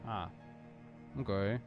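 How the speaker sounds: ordinary delivery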